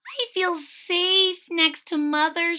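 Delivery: high, slow, small voice; cling to safety like a shy kitten; timid kitten. A narrator's reading. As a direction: kitten refusing to leave mother.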